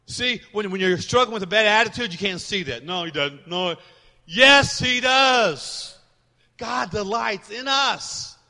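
loud call and answer, YES ringing out; preaching at full force. Acted. Pastor rebutting a bad attitude, loud and emphatic. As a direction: shout the answer back, then slow down.

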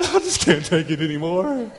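laughing through a fake collapse; hammed-up wife flopping on the bed; giggling mock lament; mock despair. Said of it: "you fall in the bed".

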